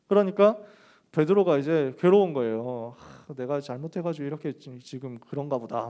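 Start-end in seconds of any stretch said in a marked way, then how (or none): 3.0-5.9 sighing distressed mutter. Retold or imitated. Peter distressed, talking to himself.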